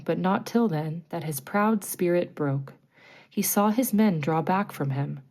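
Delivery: plain reading voice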